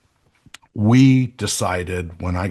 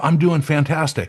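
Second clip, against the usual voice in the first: higher-pitched, upbeat quote; upbeat suggested answer; 6 semitones higher than usual. Acted. Coach urging people to change their answer, upbeat. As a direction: lift it up, urging the better answer.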